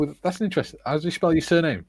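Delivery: care worker quoted. Retold or imitated. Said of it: "they go".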